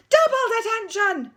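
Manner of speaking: deputy head screaming the punishment; furious shout. Acted. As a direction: roar detention at the school. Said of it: "bellowed Miss Seeth".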